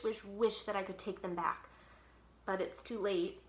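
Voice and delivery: ordinary delivery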